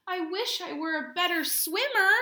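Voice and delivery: high, loud character voice; Kelp's wish. Acted. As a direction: give the little narwhal a big wistful cry. Teacher voices narwhal Kelp's line.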